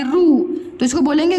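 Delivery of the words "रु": रु with a long oo; long vowel. Shown as a demonstration. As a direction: stretch रु into rooo. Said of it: "बड़ा ऊ की मात्रा".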